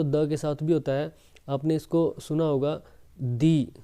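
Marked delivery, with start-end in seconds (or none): 3.2-3.7 दी said as a long "dee"; दी stretched to "dee". Shown as a demonstration. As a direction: give दी a full "dee" sound. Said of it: "दी भी बोला जाता है".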